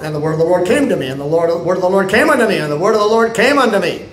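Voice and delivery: fast, rolling recitation of the refrain; rapid repeated refrain. Acted. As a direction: rattle off the refrain again and again. Quoting Ezekiel's repeated refrain.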